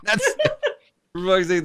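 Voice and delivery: high, laughing protest. Real, not acted.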